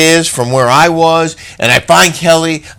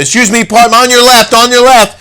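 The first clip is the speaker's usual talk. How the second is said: higher, louder calls to pass people; calling out; 11 semitones higher, 5 dB louder than usual. Retold or imitated. Rushing down the escalator, calling out politely to pass. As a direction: call ahead as you push through a crowd.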